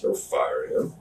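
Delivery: low, hushed plea; quiet plea. Retold or imitated. Asking the boss to spare the attacker, low and hushed. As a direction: whisper your request.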